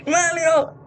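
high, desperate shouts of Mario; panicked character voice crying out for rescue; frantic calls for help. Acted. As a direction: yell for Mario as if trapped. Calling out for Mario.

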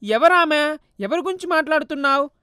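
mother startled, pressing for an answer; a shocked mother's questions; alarmed mother. Acted.

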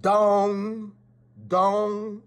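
high ringing bell dong; clock chime. Acted. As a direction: ring it out like a town clock. Clock striking noon.

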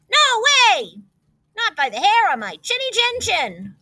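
high piglet refusal, strongest at the start; crying out. A narrator's reading. Second pig refusing the wolf, high cry. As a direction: cry out in defiance. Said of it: "cried the second little pig".

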